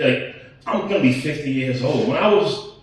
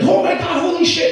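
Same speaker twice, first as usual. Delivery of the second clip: high shrieking oh my God, much louder; mock ladies screaming; 13 semitones higher, 4 dB louder than usual. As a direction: shriek like a room of excited older ladies. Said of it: "they all start screaming".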